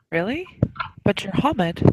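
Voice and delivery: puzzled, gently questioning with lifted pitch; mildly surprised. Acted. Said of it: "She looks a little surprised".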